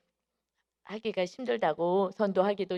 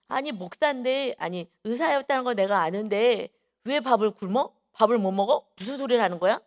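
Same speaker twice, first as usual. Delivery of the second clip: imitated disbelief, louder, rising questions; incredulous questioning; 3 semitones higher, 5 dB louder than usual. Chairman hears the pastor cannot afford food, retold incredulity.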